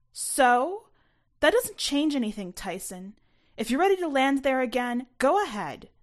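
high and loud, scornful; angry shout. A narrator's reading. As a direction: yell it at him, daring him. Mom shouting in the fight.

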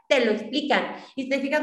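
ordinary talk, nothing marked

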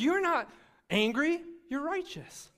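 high, loud, slowed proclamation; fervent declaration. Retold or imitated. Preacher voicing God's answer, high and fervent. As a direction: lift it up like a revelation. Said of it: "He says".

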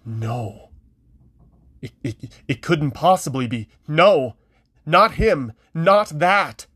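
breathless denial rising to a cry; stunned no, rising in panic; horrified disbelief. A narrator's reading. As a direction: refuse to believe what she sees.

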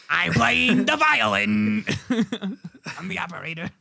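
operator impression. Factory operator playing violin as the bulldozers come, hammy impression. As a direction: do the doomed operator, words stretched out. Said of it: "It's my impression of the operator".

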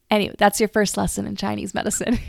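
ordinary talk

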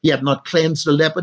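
normal talking voice

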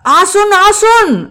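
joyful flustered welcome. Acted. Mukul greeting the visitors with flustered joy, excited high call. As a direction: fling out a flustered happy welcome. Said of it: "ఆనందంగా, కంగారుగా".

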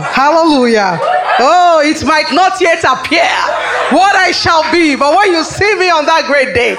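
laughing exultation. Real, not acted. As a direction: exult through laughter at full volume. Preacher exulting, laughing in the glory.

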